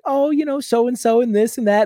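high, bright-voiced mom chatting on the phone; sweet phone mom. Mom sweetly chatting to a friend on the phone, high and sing-song. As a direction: play the sugary mom on a call.

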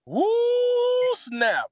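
long high "woo", then "snap"; excited whoop. Real, not acted. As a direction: whoop loudly, impressed by the story. Host reacts to moving story.

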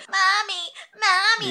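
put-on high, whining child calling for mommy; whiny little kid. Mocking the audiobook narrator's whiny little boy voice. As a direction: whine it like a clingy little boy. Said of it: "The narrator was like".

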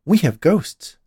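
proud announcement, high and loud. A narrator's reading. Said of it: "Dolores declared proudly".

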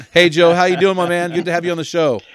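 big, high, loud hello to the guest; excited greeting. Real, not acted. Host calling out a hearty welcome to his new guest, excited. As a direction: welcome him in with energy.

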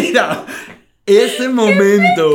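loud laughing cry. Real, not acted. Recalling the failed launch, helpless laughing shout. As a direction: crack up at the memory.

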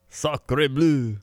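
high, hammed-up French; cartoon French invader's cry; bad French accent. France invading the squabbling kingdoms, high mock French accent. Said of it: "That's your French?".

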